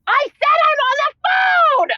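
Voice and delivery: yelling I'm on the phone at a nagging partner; angry shout. Acted. Yells at someone off the call.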